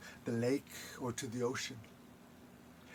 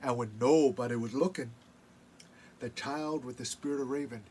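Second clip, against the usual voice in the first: story narration; 8 dB louder than usual. A narrator's reading. Storyteller telling legend.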